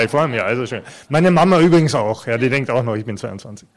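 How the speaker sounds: ordinary delivery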